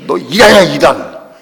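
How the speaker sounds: mock-angry rebuke, punched hard; angry mother. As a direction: imitate a mother losing her temper. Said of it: "막 화를 내시다".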